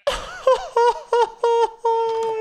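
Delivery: very high squealing laugh; helpless laughter. Real, not acted. Player laughs at the sea monster, helpless laughter. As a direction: laugh till you cry. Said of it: "Jag börjar gråta".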